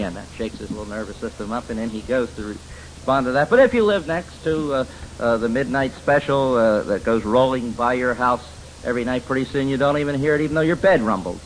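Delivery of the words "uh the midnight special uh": usual speaking voice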